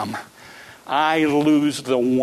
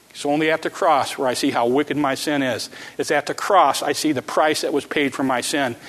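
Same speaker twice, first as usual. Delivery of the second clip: ordinary talk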